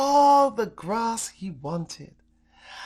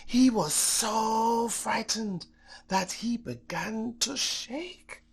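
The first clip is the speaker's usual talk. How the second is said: ordinary delivery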